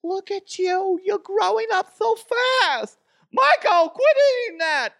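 very high falsetto doting, scolding mother; screechy-high comic mom, loud and drawn out; hammed-up fussing mama in falsetto; Mama DeHuff. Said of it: "Mama DeHuff or Mama Evans".

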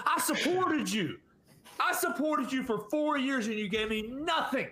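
high, laughing mock heartbreak; hammed-up jilted fan; jilted lover. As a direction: plead like a betrayed lover, half joking. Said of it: "Maybe I'm jilted lover over here".

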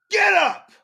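high, loud imitated yell at the hurt kid; yelling get up. Mom yells at her fallen son, high and loud. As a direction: bark it from the sideline. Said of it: "And she goes".

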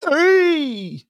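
high sharp "Hey!" like a ref's whistle; referee shout. Acted. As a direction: call out the foul. Calling a foul again.

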